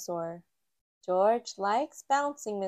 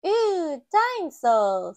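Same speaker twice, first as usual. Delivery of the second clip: put-on high, loud, slow toddler voice; a tiny piglet gasping at a toy; little George; 7 semitones higher, 8 dB louder, slower than usual. A narrator's reading. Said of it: "says George".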